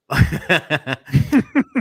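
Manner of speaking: giggling burst; high laugh. Real, not acted. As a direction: break into laughter. Laughing after apology.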